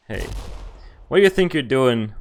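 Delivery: ordinary talk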